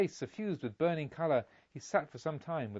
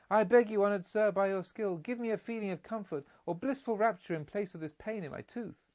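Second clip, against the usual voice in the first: put-on pleading child; 6 semitones higher than usual. A narrator's reading.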